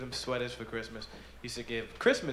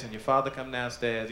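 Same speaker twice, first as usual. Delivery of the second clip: ordinary talk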